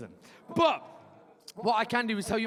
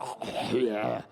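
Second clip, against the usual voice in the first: imitating a moan; 11 semitones lower than usual. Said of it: "she went".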